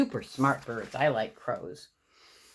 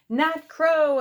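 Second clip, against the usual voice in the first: much higher and louder, gleeful; a kid yelling with joy at birds; excited shout; 12 semitones higher, 7 dB louder than usual. A narrator's reading. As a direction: shout it out to the sky. Child spotting birds, shouting.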